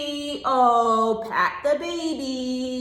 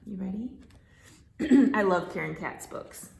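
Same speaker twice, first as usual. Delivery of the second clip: usual speaking voice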